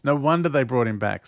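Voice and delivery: normal talking voice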